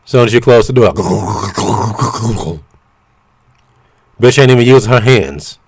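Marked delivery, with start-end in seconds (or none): 1.0-2.6 short laugh. Real, not acted. Laughing at own joke.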